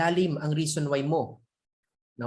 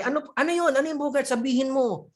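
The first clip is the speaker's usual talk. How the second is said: lightly acted impatient king, pitched up; higher, insistent royal questioning; eager urging; 5 semitones higher than usual. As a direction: press eagerly for an answer. King presses Bogart for his wish.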